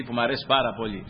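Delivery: ordinary talk, nothing marked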